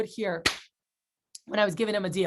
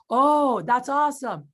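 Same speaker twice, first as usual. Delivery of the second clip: big, higher and louder show of delight; excited; 3 semitones higher, 4 dB louder, slower than usual. Retold or imitated. Rep hearing deal beside customer, acting excited. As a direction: gush for the customer's benefit. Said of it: "get excited in front of the customer".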